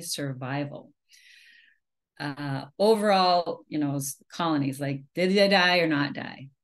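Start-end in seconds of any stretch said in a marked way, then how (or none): none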